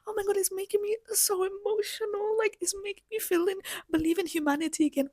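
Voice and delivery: high, breathless mock-gushing voice; mocking gushing TikTok fan. Mocking TikTok viewers who gush over the moon trip. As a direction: parody a teary TikTok fan. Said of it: "people are falling for this propaganda on TikTok".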